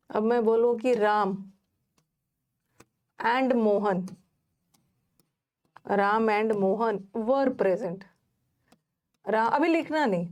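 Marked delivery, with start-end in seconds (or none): 0.9-8.0 very slow, word by word, as if for note-taking; slow dictation. Shown as a demonstration. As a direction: dictate it slowly to the class. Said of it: "teacher dictates example sentence". (धीमी बोलकर लिखवाना)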